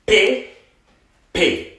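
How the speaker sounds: "P P" as two separate high "pay" syllables; unaccented vowel. Shown as a demonstration. Teacher drills the unaccented vowel, "P P" as pay, pay. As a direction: say "P P" high, closed "ay", pause between. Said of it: "without the accent".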